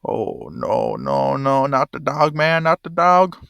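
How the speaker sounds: loud, drawn-out pleading dismay; distressed protest. Real, not acted. Player dreading harm to the dog, distressed loud pleading. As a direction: plead loudly, stretching the last words.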